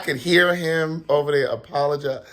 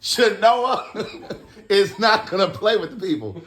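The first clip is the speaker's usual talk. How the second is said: cracking up mid-word, pitched up; laughing; 5 semitones higher than usual. Real, not acted. Telling friend Chenoa won't play, laughing. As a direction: talk through laughter. Cracking up while retelling an incident.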